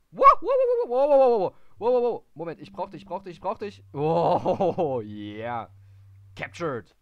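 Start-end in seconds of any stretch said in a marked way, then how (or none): 0.1-2.2 loud, high rapid string of wow; excited wows. Real, not acted. Gamer yelling wow as the puzzle suddenly works. 3.9-7.0 triumphant cheer. Real, not acted. Gamer celebrates capture.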